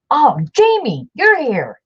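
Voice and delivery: high, loud delighted hello; light up as a friend walks in; excited greeting. Real, not acted. Host greeting a viewer who just joined, thrilled.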